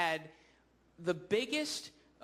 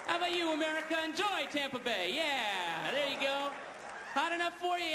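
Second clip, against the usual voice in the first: high-pitched, gleeful revenge patter; cocky elephant playing showman; energised elephant taunting; 7 semitones higher than usual. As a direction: dish it out to the crowd in a raised voice. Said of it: "now it's starting to get its energy".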